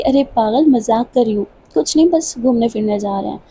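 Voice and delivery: ordinary delivery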